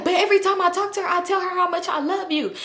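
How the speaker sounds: high-pitched mock protest; high-voiced pleading impression of a friend; retold protest. Retold friend protesting her love.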